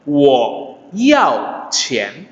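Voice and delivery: "wo" low, "yao" sharply falling, "qian" rising; correct Mandarin tones. Shown as a demonstration. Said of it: "yang pertama adalah benar".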